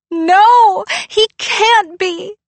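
high, loud, slow sobbing protest; crying denial. Acted. Girl refuses to believe her dog died, crying. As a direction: plead against the news through tears.

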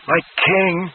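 high, excited cry of homage; joyful cry. A narrator's reading. Dennis kneeling to Peter, crying out in high joy. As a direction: call out in dizzy joy. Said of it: "Dennis cried".

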